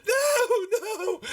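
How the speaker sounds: piercing falsetto scream; hammed-up halfling terror; screaming bloody murder. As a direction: shriek it loud, then let it drop. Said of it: "it just screams bloody murder".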